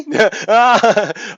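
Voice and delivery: high burst of laughter; loud laugh. Real, not acted. Seeing the supremacist's photo, laughing loudly.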